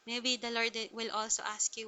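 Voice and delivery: normal talking voice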